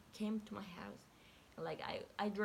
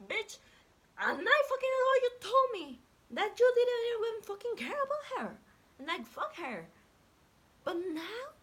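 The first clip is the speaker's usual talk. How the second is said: much higher, much louder outraged screaming; furious yelling; 10 dB louder than usual. Retold or imitated. Girl screams at her cheating ex, furious. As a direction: scream at him, outraged and betrayed.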